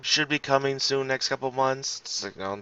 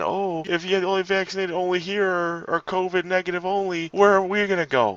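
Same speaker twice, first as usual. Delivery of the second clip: mock voice pitched much higher; mocking the anti-vaxxers; 8 semitones higher than usual. Host mimics anti-vaxxers at restaurants, high mocking voice. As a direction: parody them, high and pleading. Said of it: "these damn morons out at restaurants saying".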